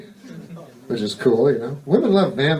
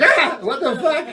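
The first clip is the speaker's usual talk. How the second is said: high mock yelp of fright; startled yelp; 12 semitones higher than usual. Startled by a ghost, a high laughing yelp. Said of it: "You're like".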